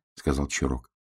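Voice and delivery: ordinary delivery, nothing marked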